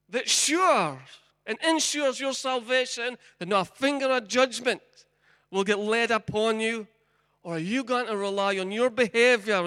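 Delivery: loud, high, driving sermon delivery; forceful preaching. Acted. Preacher warning the congregation about pleading to Moses, loud forceful preaching. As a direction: press the congregation hard, rising and loud.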